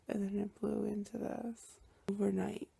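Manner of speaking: usual speaking voice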